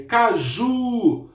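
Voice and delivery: "caju" said slowly, JU held long; stress on JU. Shown as a demonstration. Teacher showing pupils the tonic syllable, long stressed JU in "caju". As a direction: lean on and stretch the "ju" in "caju". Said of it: "O ju é a minha sílaba mais forte".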